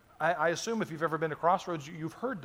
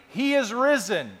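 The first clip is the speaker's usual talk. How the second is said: higher, louder and slower, a joyful proclamation; with real conviction; 6 semitones higher, 6 dB louder, slower than usual. Shown as a demonstration. Pastor calls out to the congregation, jubilant and certain. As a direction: proclaim it like it's really true and people need to hear it. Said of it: "let's say it like you really believe it".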